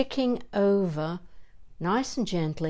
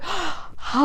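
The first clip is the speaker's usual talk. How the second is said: much higher, thrilled sighing ah; delighted sighing ah; 10 semitones higher than usual. Shown as a demonstration. Teacher hearing of a three-film franchise, thrilled ah. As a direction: gasp then release a joyful ah. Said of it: "And I go, gasps".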